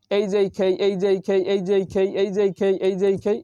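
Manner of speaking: quick repeated letters in one breath; fast drill. Shown as a demonstration. Teacher shows the tongue-twister drill at speed. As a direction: rattle off এই যে এই খেই quickly. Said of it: "এইভাবে আপনারা দ্রুত".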